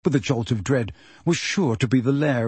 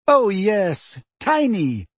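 higher, mock-agreeing sarcasm; hysterical sarcasm; 6 semitones higher, slower than usual. A narrator's reading. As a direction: pretend to agree, near hysterics. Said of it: "with a kind of hysterical sarcasm".

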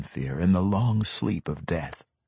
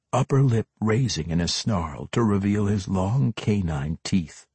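ordinary delivery

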